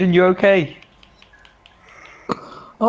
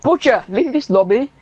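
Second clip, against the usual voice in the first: mock Russian accent; 2 semitones higher than usual. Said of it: "say with a Russian accent".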